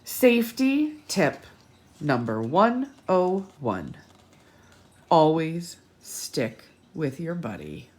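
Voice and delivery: slow emphatic safety tip; carefully measured reading; slow, deliberate reading. Acted. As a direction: spell it out slowly for the kids.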